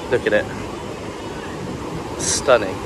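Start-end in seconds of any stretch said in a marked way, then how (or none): none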